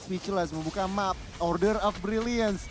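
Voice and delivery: normal talking voice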